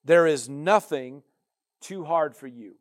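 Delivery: loud, slow, preaching declaration; booming scripture read. Acted. Preacher booming Jeremiah's prayer to the Lord. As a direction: proclaim the prayer with full force. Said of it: "and he says this".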